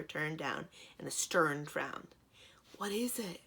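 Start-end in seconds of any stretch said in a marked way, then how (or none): none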